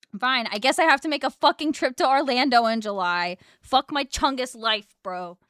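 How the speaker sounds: loud, high frustrated groan of words; vent, annoyed; exasperated rant. Real, not acted. Frustrated forced trip.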